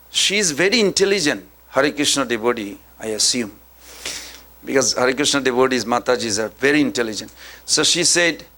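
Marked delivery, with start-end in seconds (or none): none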